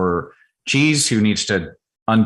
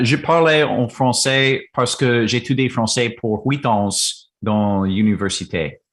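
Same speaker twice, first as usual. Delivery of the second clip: French. Shown as a demonstration. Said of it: "Bonjour, mommy".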